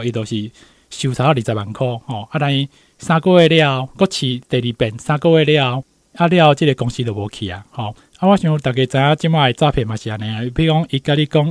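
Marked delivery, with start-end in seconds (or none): none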